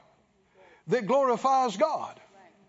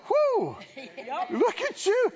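high, impressed exclamation; admiring whoo; 7 semitones higher than usual. Retold or imitated. People admiring a victorious believer, high and impressed. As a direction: wow them like a stunned onlooker. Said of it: "people look at you and go".

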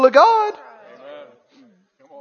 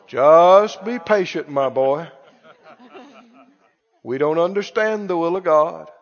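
preacherly resignation; 6 semitones lower, 4 dB quieter than usual. Acted. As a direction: parody a preacher gently counselling submission. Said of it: "talk like this and pray like this".

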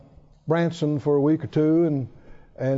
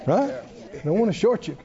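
normal talking voice

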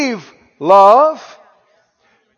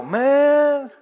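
envious amazement; 4 semitones higher than usual. Acted. Onlookers voiced marvelling at believers.

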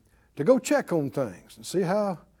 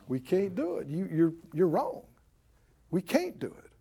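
soft, deflated doubting voice; defeatist; 7 dB quieter than usual. Acted. The people answer Caleb with doubt, defeatist. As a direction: mumble the objection, much softer.

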